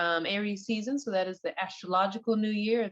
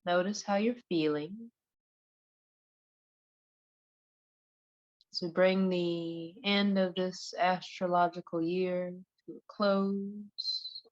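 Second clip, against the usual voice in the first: guided meditation. Acted. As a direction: ease them gently into relaxation, one unhurried phrase at a time.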